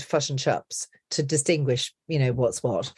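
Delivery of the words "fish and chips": Kiwi "fish and chips" as "fush and chups"; New Zealand centred short i; New Zealand accent. Shown as a demonstration. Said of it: "and the New Zealand".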